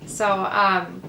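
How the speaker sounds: ordinary delivery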